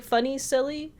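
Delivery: ordinary talk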